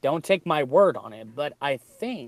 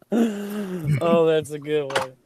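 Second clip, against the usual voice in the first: laughing; 3 dB louder, slower than usual. Real, not acted. After joke.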